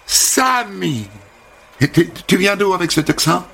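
high, gushing hairdresser greeting; sing-song high welcome; bubbly American hairdresser. Acted. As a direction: chirp it like an overfriendly American. Holly reacts to his name.

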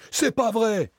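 high, loud frantic denial; panicked denial. Acted. Varin, accused of murder, cries out in denial. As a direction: deny it in panic, voice cracking upward.

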